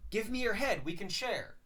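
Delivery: high, loud, gleeful werewolf plea; eager werewolf voice. Retold or imitated. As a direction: jump up into a giddy, hungry ask. Werewolf begging for a head.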